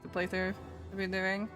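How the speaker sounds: ordinary talk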